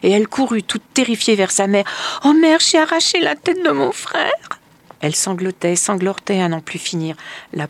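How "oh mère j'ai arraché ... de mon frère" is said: high, loud panicked cry; a child screaming in horror; Marlène crying out to her mother, high and panicked; terrified wail. A narrator's reading. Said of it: "se mit à hurler en pleurant".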